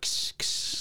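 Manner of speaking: unvoiced sizzling sound of wires; whispered sparking noise. Host imitates Tina sparking the wires, whispered noise.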